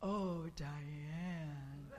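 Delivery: low, lingering way of saying a name; his voice. Retold or imitated. Author greeting Diane at the women's conference, low and lingering. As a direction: say her name low and slow, savoring it. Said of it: "this was his voice".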